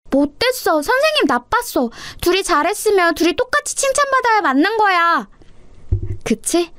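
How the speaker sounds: put-on very high, whiny child protest; indignant little sister. Little sister objects to the teacher favouring one pupil, indignant. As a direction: complain like a small girl at unfairness.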